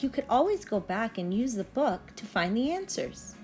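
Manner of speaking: usual speaking voice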